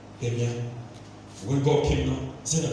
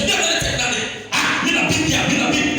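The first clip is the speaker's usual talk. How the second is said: friend bragging; 10 semitones higher, 5 dB louder than usual. Retold or imitated. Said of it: "a friend just said".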